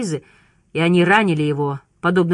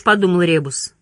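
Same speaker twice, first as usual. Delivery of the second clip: ordinary delivery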